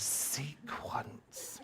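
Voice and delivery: Sequence whispered with a delighted laugh; awed whisper. Shown as a demonstration. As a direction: breathe Sequence in wonder. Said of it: "Oh, isn't it gorgeous?".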